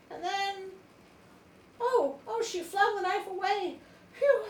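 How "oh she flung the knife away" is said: ordinary delivery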